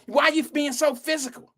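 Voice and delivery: high-pitched mock innocence; acting all innocent. Girl who started trouble now protesting, high and falsely innocent. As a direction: play the instigator feigning victimhood. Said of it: "try to act all innocent".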